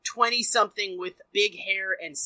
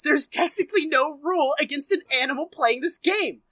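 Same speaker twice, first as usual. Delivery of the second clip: normal talking voice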